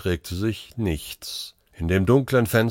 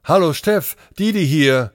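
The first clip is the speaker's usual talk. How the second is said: higher-pitched, brisk radio greeting; raised, pressing voice on a call; urgent doctor; 7 semitones higher, 4 dB louder than usual. Acted. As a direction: hail your colleague with some urgency. Doctor hails colleague over radio.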